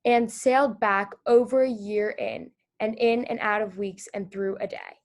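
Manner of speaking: calm storybook reading winding down to the end; reading to children. Acted. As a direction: bring the story gently to its close. Teacher reads story to children.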